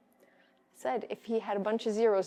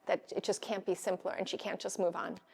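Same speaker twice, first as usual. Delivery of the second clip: ordinary talk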